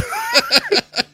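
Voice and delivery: high-pitched villain giggle; high staccato cackle; mad cackle. Acted. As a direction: giggle like a crazed scientist. Mad scientist cackles in story.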